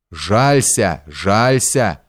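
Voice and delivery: mildly enraged, drawn-out taunt; furious jeering, restrained. A narrator's reading. Soldiers taunt Pyotr's threat to complain, understated furious jeer. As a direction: jeer it with contained fury. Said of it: "с ещё большей яростью кричали стрельцы".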